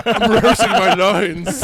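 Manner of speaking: laughing. Real, not acted. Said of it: "Give it up for Philip Seymour Hoffman".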